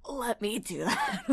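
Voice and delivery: slow, laughing husky take. Shown as a demonstration. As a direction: husk it up slowly with a laugh. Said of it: "Like, like that".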